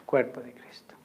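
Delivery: hushed whisper. Real, not acted. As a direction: whisper it reverently. Giving communion.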